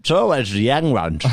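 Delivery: mild mock tut-tutting; mock lament. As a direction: toss it off as a jokey lament. Repeats a line as a bit.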